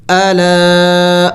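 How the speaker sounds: الا very slow, alif held long; الا with the alif drawn out; lengthened alif. Shown as a demonstration. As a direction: stretch the vowel of الا. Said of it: "اور بھی زیادہ لمبا کرنا ہوگا".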